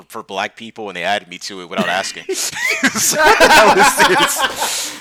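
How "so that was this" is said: laughing through the words, slow and loud; cracking up while he says it. Real, not acted. Cracking up over the Slack channel, laughing.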